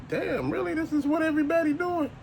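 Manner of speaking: a hushed, high-pitched "really?"; disbelieving surprise. Retold or imitated. Hushed, high disbelief at what the Hot Wheels groups are doing. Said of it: "And I'm like".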